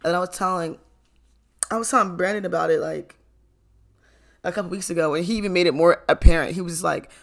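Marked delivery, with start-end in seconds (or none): none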